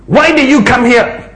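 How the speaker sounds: high, sharp demand; angry older brother. Acted. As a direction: snap it at the younger brother. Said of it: "His anger was aroused against David".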